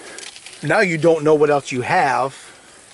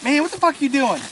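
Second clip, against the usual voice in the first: panicked victim; 10 semitones higher than usual. Acted. Acted victim panicking at treatment.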